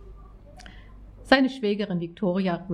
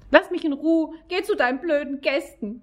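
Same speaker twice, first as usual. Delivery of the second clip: sobbing; 8 semitones higher than usual. A narrator's reading. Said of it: "brachte sie zwischen Schluchzern hervor".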